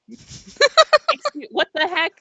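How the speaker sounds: high, laughing backtrack after swearing; flustered self-correction. Acted. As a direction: catch yourself and hastily amend it. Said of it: "stops and he's like".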